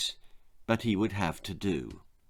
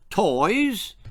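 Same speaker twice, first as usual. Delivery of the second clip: high, mocking "Toys?"; scoffing laugh; 9 semitones higher, 3 dB louder than usual. A narrator's reading. Amos mocks his wife's suggestion with a scoffing laugh. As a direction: scoff at the very idea. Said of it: "laughed Amos".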